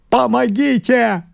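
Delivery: high, drawn-out cry for help; desperate cry. A narrator's reading. Father begs the doctor for help, crying out. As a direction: cry out in sudden anguish. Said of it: "а потом крикнул".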